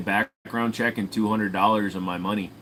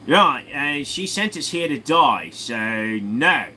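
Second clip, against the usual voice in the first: higher-pitched put-on Australian; Australian accent; 5 semitones higher, 4 dB louder, slower than usual. Australian refuses the Brit's request, broad Australian accent. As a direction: answer back in broad Australian. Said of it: "argument between a British and Australian".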